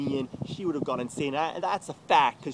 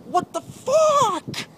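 much higher, louder, slow drawn-out disbelief; stunned outcry; 7 dB louder, much slower than usual. Real, not acted. Reacting to her accusation, stunned loud outcry. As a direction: let the shock burst out.

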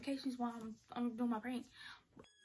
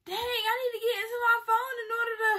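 panicked whine; 10 semitones higher, 9 dB louder than usual. Real, not acted. Panicking over the phone.

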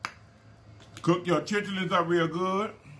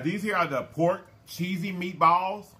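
ordinary talk

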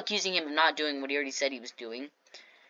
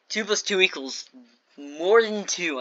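brain-dead mock. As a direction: labour over it like someone dim. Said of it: "full brain dead mode".

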